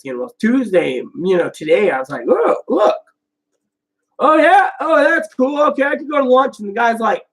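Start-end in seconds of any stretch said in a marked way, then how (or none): 2.3-6.6 high-pitched acted nonchalance; mock-breezy. Announcing lunch to the clinic guy, mock-breezy. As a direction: toss it off in a raised voice.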